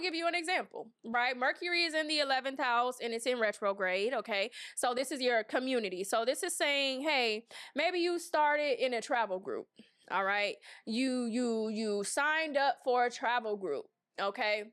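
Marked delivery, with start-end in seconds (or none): none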